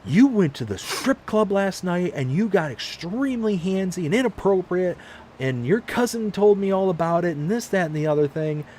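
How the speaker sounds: high, hammed-up accusing wife; wife listing his sins from last night, high and mock scolding. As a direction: rattle off the charges in a high, scolding voice. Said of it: "And she's like".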